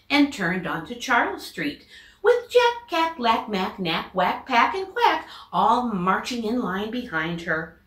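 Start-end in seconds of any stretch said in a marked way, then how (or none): none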